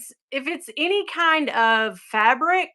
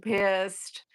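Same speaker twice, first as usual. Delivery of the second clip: ordinary talk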